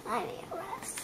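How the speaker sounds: breathless whisper with a sigh. Pretend. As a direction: whisper it like you're worn out. Pretend tired, whispering to papa.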